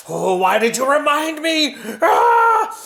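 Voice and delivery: high, loud frustrated wail; exasperated king. Acted. King reminded he has no gloves, loud frustrated wail.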